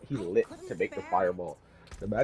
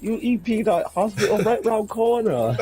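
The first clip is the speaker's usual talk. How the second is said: much higher laughing mock Malaysian-Chinese accent; mock Uncle Roger accent; 10 semitones higher than usual. Friend quoting the bit, laughing mock Malaysian-Chinese accent. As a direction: quote the uncle through laughter.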